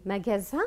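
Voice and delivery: "Magasin" with a voiced z in the middle; Z sound. Shown as a demonstration. Teacher offers students the first choice, "Magasin" said with z. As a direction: buzz the s of "Magasin" as z. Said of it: "qu'est-ce qu'on dit ici?".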